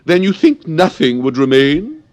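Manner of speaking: high, loud, mocking queen voice; Red Queen. Red Queen mocking Alice's answer, high and loud. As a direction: pounce on her answer like a scornful queen. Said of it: "said the Red Queen".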